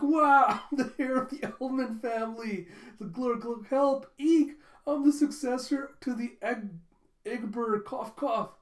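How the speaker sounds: a comic, high, loud sputtering panic; hammed-up drowning panic. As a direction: ham up drowning. Flunkies flailing in water.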